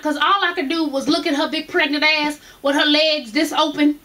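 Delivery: ordinary talk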